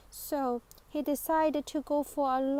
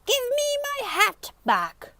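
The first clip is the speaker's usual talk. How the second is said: high, loud furious bellow; thundering; 12 semitones higher, 6 dB louder than usual. Acted. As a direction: yell at him to hand the hat back. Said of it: "he thundered".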